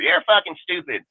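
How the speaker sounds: ordinary talk, nothing marked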